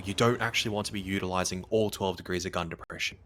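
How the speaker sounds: usual speaking voice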